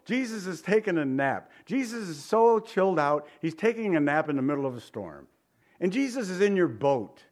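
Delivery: normal talking voice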